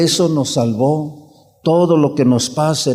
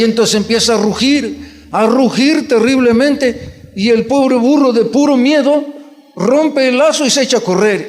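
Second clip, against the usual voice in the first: dramatic telling; 6 semitones higher than usual. Acted. Preacher dramatizes a roaring lion.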